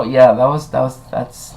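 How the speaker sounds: normal talking voice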